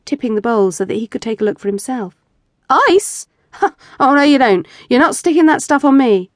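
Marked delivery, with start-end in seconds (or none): 2.7-6.3 higher, louder, laughing refusal; laughing protest. Acted. Husband laughs off his wife's ice game.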